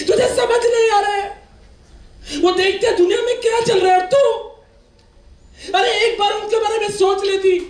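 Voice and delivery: high, loud impassioned sermon; fiery preaching. Real, not acted. Speaker scolds daughters in the audience, loud impassioned preaching. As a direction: preach it at full force to the crowd.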